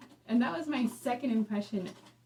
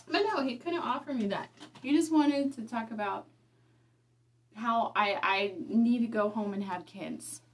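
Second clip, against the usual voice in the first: normal talking voice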